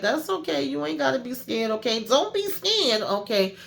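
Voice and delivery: put-on high-pitched, babying taunt; mock reassurance. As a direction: coo it high, as if soothing a scaredy-cat. Interrogator mock-reassuring a defiant captive.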